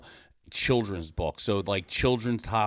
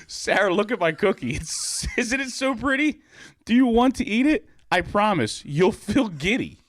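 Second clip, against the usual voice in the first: put-on high-pitched, excited girlish voice; high, eager little girl voice; eager Susie; 10 semitones higher, 5 dB louder than usual. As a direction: sweetly tempt him. Susie character voice.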